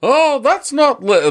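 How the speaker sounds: high, drawn-out announcer yell; sports game announcer. Retold or imitated. Quoting NFL Blitz to the co-host, game announcer voice. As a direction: stretch it like an excited arcade commentator. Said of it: "who is the voice actor".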